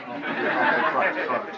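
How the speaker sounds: normal talking voice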